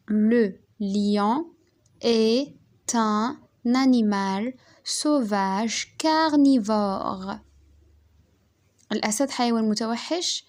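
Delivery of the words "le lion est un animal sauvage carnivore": very slow dictation, pausing between words. Shown as a demonstration. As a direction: spell it out piece by piece for pupils to copy.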